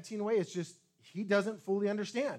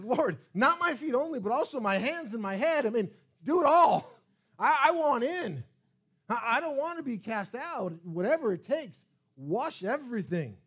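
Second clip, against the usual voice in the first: eager plea; 4 semitones higher, 7 dB louder than usual. Retold or imitated. Peter eagerly begging Jesus.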